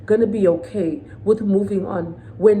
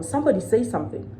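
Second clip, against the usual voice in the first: ordinary delivery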